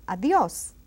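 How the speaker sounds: "Adios" with a strong final O; "Adios" pronounced strongly on its last syllable; stress on the O. Shown as a demonstration. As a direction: hit the O in "Adios" firmly. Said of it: "it's pronounced strongly".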